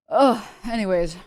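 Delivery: ordinary delivery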